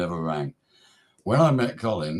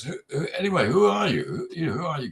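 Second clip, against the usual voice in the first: put-on American accent; 6 semitones higher than usual. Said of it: "Can hear he's American".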